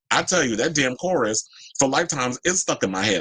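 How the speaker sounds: ordinary delivery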